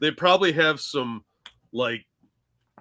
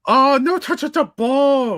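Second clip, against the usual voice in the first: put-on slow, high, loud Japanese accent; 12 semitones higher, 7 dB louder than usual. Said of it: "In deep Japanese accent".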